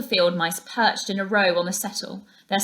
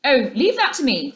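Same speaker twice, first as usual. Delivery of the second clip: confident and louder; masterful; 5 semitones higher, 5 dB louder than usual. A narrator's reading. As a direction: take command calmly. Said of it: "said the masterful rat".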